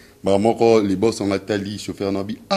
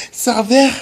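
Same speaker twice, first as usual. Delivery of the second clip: high, shrill-free falsetto call to the waiter; mock woman ordering; 7 dB louder, much slower than usual. Comedian mimics a woman calling the waiter. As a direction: play the lady summoning the waiter, high and drawn out.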